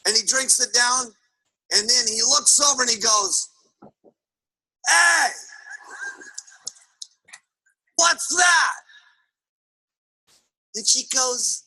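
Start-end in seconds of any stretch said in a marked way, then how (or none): 4.9-8.8 hammed-up bullying husband calling across the room; gruff negative husband. Beer-drinking husband spots wife's diet box, demanding.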